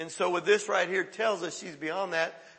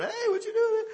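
put-on much higher, chirpy teenage greeting; teen girl on the phone. Preacher mimics a teenage girl chatting on her phone, much higher voice. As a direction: toss it off like a bored teen texting. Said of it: "they're going".

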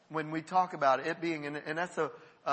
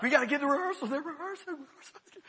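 panicked self; 8 semitones higher than usual. Retold or imitated. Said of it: "I'm like".

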